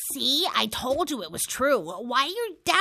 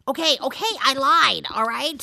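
raised-voice admission; exasperated confession; 3 semitones higher, 5 dB louder than usual. Acted. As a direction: give in loudly, voice pushed up. Pressed into confessing a lie.

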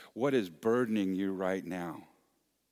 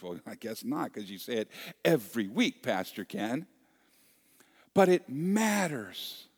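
loud, climbing sermon delivery; rousing preacher, louder and higher, building; fiery preaching; 4 semitones higher, 6 dB louder than usual. Acted. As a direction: preach it with full force to the congregation. Preacher rising to full force.

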